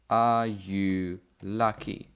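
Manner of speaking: slow, deliberate model question for learners. Shown as a demonstration. Teacher modelling for Polish learners, slow and clear. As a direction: lay out each word slowly so students can follow. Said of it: "teacher models the question".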